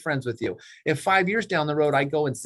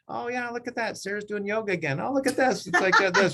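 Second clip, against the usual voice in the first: higher, amused, half-laughing commentary; mock scrolling chatter; 8 semitones higher than usual. Agent idly scrolling Facebook posts, amused higher chatter with laughs. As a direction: gush over friends' posts with a laugh.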